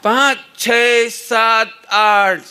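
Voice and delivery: loud shouted count. Shown as a demonstration. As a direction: shout each number out. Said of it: "अभी मैं बताता हूँ".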